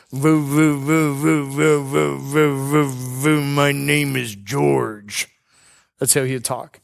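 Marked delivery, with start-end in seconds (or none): 0.1-5.3 slow, struggling repeated "voo" before the name; imitating George's Parkinson's speech. Pastor imitating his friend George introducing himself, slow struggling speech. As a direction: mimic a man fighting to get words out, very slowly. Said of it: "he would talk like this".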